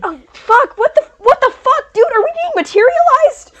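high, loud panicked yelling; panicked freak-out. Acted. Ghost yelling in panic at his new flesh. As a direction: scream it in horror at your own body.

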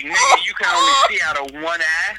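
loud mock lecture to a one-eyed man; mock scolding shout. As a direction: yell at him like you can't believe it.

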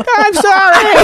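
high, loud mock-whimpered apology; pleading apology. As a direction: imitate your groggy self begging the nurse's pardon. Pleading with the furious nurse.

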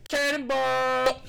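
long, high, stretched-out yell of the word; slow, high announcer-style shout; drawn-out catchphrase call. Acted. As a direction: belt it out like a big cannonball jump.

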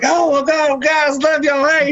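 high-pitched gushing mock greeting; excited greeting. Movie character greets the family on coming home, hammed-up excitement. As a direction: imitate an overexcited man greeting everyone. Acts out returning man in movie.

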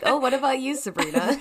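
high, loud, sing-song politeness; mock polite voice. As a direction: ham up a polite hostess. Mock polite quote of herself.

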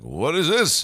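high, swooping, loud imitation; mock bafflement. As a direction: exclaim as a bewildered teacher, hammed-up. Teacher baffled by delivered paper.

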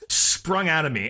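ordinary talk, nothing marked

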